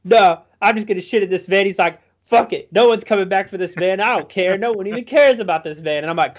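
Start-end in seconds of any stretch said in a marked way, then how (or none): 0.0-1.7 raised, louder mock kid voice; stubborn little boy. Boy refuses to leave the van, mock defiance. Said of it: "seven or eight". 2.2-6.0 high, insistent mock kid voice; defiant little boy. Boy argues with older kids, mock defiant and high. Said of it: "seven or eight".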